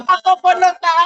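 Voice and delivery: emotional loud. Real, not acted. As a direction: call it out, shaken and loud.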